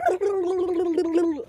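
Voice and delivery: high rolling "blublublu" babble; crazy babbling noise. Retold or imitated. Retelling waving arms at the window, high crazy babble. Imitates own crazed outburst at window.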